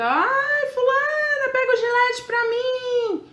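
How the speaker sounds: husband shouting from shower for a razor; husband yelling from the shower. Retold or imitated. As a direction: call out from the bath like a helpless husband. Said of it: "de repente, grita".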